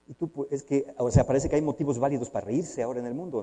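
usual speaking voice

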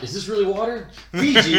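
high, loud mock disbelief; hammed-up incredulous query; mock suspicion. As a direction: question it like a picky drinker, jumping up loud. Acts out inspecting the bottle.

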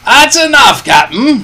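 high, loud, cheerful holler; Long John Silver's cheery shout. A narrator's reading. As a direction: holler back in good cheer. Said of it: "shouted Long John cheerily".

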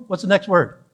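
ordinary talk, nothing marked

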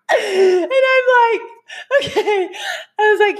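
high squeal through real laughter; cracking up. Real, not acted. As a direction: struggle to speak through the giggles. Cracking up retelling the mishap.

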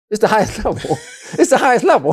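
high giggle breaking into the words; giggly, losing composure mid-sentence; giggling. Real, not acted. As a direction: talk through a rising giggle. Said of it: "doing your little giggle".

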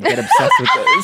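high squeaky mock voice; mock sinister. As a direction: pitch it way up, teasing. Teasing fake gift pitch.

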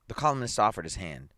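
ordinary delivery, nothing marked

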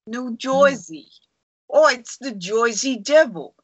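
put-on playful New Jersey "Joisey" accent; hammed-up New York-New Jersey accent. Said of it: "Do that in a Jersey accent".

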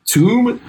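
tomb said as toom, with a high rise; tomb pronounced. Retold or imitated. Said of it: "He would make a demonstration".

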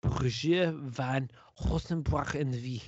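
slow French accent drifting toward German; mock French with a Germanic slip. Shown as a demonstration. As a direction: draw it out in an overdone French accent. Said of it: "Give us your best French accent".

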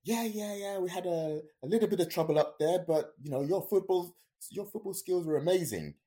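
high, loud, breezy reassurance; cheery instructor. Retold or imitated. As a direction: brush it off cheerfully and loudly. Instructor cheerfully reveals trouble.

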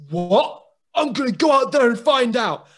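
loud, high furious outburst. Retold or imitated. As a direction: storm off shouting. Sam furious, storming out.

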